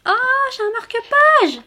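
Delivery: high, slow delighted gasp; excited surprise. Real, not acted. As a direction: squeal at the find. Découvre un marque-page caché. (surprise ravie, aiguë et lente)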